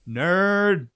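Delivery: high, loud mocking taunt; mocking jeer. Co-host jeers at the governor in a loud nasal taunt. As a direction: sneer it like a schoolyard insult.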